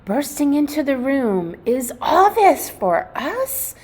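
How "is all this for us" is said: higher, louder eager puppy voices; excited puppies. Acted. The puppies bursting into the room, excitedly asking. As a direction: squeal it like bouncing puppies.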